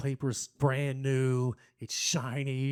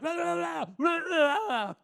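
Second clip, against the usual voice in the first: high, wild cartoon gibbering; frantic high-pitched mock rage babble; Tasmanian Devil; 13 semitones higher than usual. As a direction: rant like a raging cartoon beast. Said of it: "like the Tasmanian devil".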